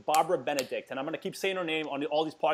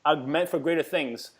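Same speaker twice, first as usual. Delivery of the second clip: slow, earnest, heartfelt; slow conviction of a mentor; sincere encouragement; slower than usual. Retold or imitated. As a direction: lean in and tell him he's worth more. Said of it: "she goes".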